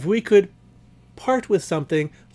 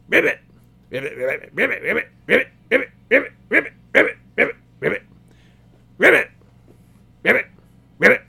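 croaking frog chorus; 5 semitones higher, 4 dB louder, much faster than usual. Acted. Vampire frogs croaking back at the party in a wild chorus. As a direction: croak it out like a whole pond of frogs.

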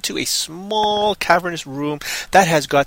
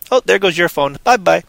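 ordinary talk, nothing marked

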